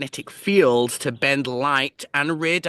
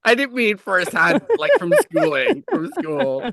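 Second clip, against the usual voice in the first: laughing; 8 semitones higher, 3 dB louder than usual. Real, not acted. Laughing defensive correction.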